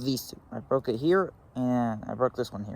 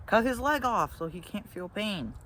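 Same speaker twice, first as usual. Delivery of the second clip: higher, slow, lightly voiced kid sister; imitating little sister; 7 semitones higher than usual. Little sister suggesting they cut his leg off, higher and slow. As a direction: play the eager little sister, slowly. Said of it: "she's like".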